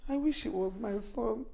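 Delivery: low, subdued wishing; wistful lament. Acted. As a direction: murmur a regretful wish to yourself. Mother laments, envying another's son.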